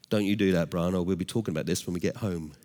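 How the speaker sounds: low, hushed threat from dad; quiet fatherly warning. Retold or imitated. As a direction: warn your son under your breath. Dad's discreet warning to son.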